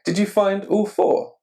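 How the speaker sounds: ordinary delivery, nothing marked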